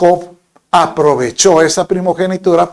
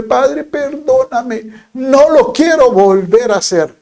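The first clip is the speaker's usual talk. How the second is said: much higher, slow pleading prayer; mock penitent; 9 semitones higher than usual. Sinner pleading to the Lord, hammed-up penitent whine. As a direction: beg forgiveness in a hammed-up whine. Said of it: "empiezan diciéndole".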